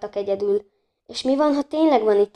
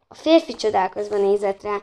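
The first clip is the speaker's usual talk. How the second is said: usual speaking voice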